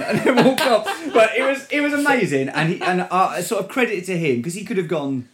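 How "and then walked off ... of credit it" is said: much higher and louder, laughing through it; laughing excitedly. Real, not acted. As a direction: burst out laughing with delight. Laughing excitedly after the meeting.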